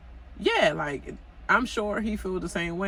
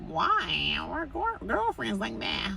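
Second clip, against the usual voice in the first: higher put-on voice, innocent act; lightly voiced mock Marlo; 11 semitones higher than usual. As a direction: play it like she's faking surprise. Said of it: "I can't do man, man voice".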